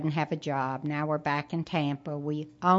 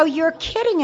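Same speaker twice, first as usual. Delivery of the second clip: disbelief; 10 semitones higher, 6 dB louder than usual. Retold or imitated. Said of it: "And I go".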